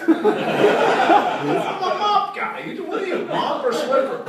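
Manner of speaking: high, very slow drawn-out boast; mock proud declaration. Real, not acted. Comic declares his mop loyalty to the crowd, high and slow. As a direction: stretch it out high like a proud claim.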